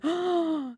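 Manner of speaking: a sharp, high gasp of disbelief; shocked gasp. Real, not acted. Co-host hears the husband's insult, shocked gasp. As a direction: suck in air, appalled. Reacting to husband's cruel remark.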